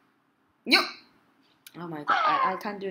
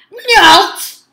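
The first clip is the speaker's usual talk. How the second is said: very loud, high, stretched note; shouted jump command; 14 semitones higher, 17 dB louder than usual. Real, not acted. Player making the game character jump, shouting high. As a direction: belt one long high syllable.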